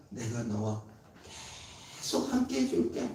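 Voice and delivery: soft, hushed reassurance, nearly breathed; tender promise. Retold or imitated. God reassuring the frightened Jacob, soft and tender. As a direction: comfort him gently and quietly. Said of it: "이렇게 얘기하는 거예요".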